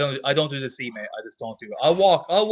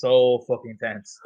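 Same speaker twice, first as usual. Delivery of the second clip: drawn-out emphasis; 3 dB louder, slower than usual. Real, not acted. Stretching out the tension.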